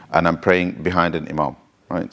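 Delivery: ordinary delivery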